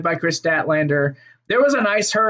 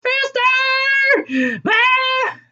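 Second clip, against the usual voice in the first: very high screechy mock yell; imitated yelling; much slower than usual. Podcaster imitating Vicky yelling at the ref, high mock shriek. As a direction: shriek it like a manager berating the ref. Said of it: "Vicky's just yelling at the ref".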